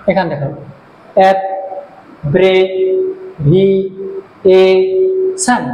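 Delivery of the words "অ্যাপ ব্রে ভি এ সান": অ্যাপ, ব্রে, ভি, এ, সান evenly separated; syllable by syllable. Shown as a demonstration. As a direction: pause after each of অ্যাপ, ব্রে, ভি, এ, সান. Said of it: "পাঁচটা syllable আছে".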